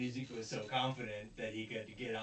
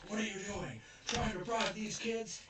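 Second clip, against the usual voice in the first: indignant vice principal; 8 semitones higher than usual. Retold or imitated. Vice principal scolds Onizuka for bribing kids, mock indignant. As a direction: act out a mildly scandalized school official. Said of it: "the vice principal's like".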